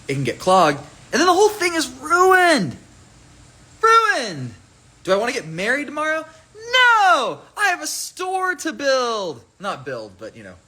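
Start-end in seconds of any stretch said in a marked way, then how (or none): 1.1-4.5 high, hammed-up wail of disaster; comic over-the-top lament; mock despair. Mock despair over a clogged bottle. 5.0-9.4 high-pitched hammed-up protest; comic melodrama in a squeaky high voice; mock outburst. Acts out frantic protest about wedding.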